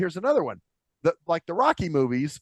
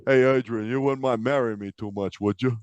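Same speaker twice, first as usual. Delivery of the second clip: Rocky; 7 semitones lower than usual. Retold or imitated. Imitating the boxer proposing to Adrian, mumbled Rocky voice. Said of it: "All I hear is him going".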